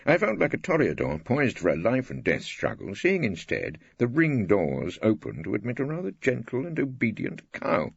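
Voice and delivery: ordinary delivery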